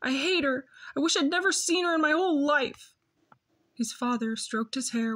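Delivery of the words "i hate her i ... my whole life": rising, higher sobbing outburst. Acted. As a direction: let the grief spill over. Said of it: "Jess said through his sobs".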